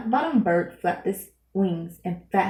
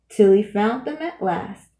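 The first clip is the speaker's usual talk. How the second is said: plain reading voice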